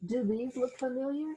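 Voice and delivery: ordinary delivery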